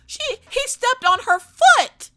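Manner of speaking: high, loud, drawn-out protest; hammed-up indignant shriek; mock hysterical yell. Owner answers receptionist's question, mock hysterical.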